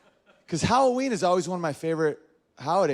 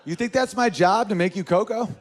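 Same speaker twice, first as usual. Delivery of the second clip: indignant wife; 6 semitones higher, 4 dB louder than usual. Retold or imitated.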